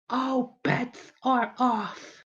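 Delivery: high character voice, word by word; drawn-out threat. Acted. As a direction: stretch every word into a warning. Skit threat repeated for menace.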